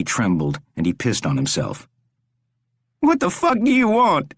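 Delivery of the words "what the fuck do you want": high, frantic demand; terrified Rory. A narrator's reading. Trapped Rory demands what the stranger wants, frantic. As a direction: lash out from sheer terror.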